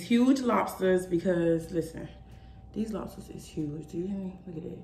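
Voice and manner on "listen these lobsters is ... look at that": softly, leaning in close; hushed show and tell. Real, not acted. Hushed over food.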